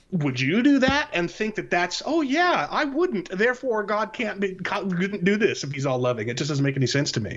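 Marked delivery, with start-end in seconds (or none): 0.1-1.1 quoting the skeptic. Retold or imitated. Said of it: "anybody would hear". 2.0-6.3 higher, lightly mocking voice of a gullible hearer; a hint of a gullible listener nodding along; mock naive listener.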